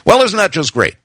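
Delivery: high-pitched sarcastic complaint; snotty. A narrator's reading. Wife complains about litter of lizard babies, snotty. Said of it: "she was being snotty here".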